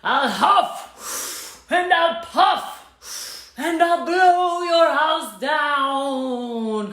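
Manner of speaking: very slow drawn-out threat; big bad wolf. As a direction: stretch each word like a huffing wolf.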